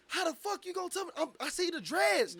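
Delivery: high, loud indignant yelling; indignant shout. Retold or imitated. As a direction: argue back, sure it's him. Arguing with security, retold.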